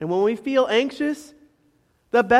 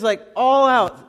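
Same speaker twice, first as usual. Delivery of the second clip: normal talking voice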